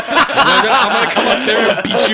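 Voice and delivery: loud, laughing through a mock threat; high and loud, laughing through it. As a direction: retell his threat while cracking up.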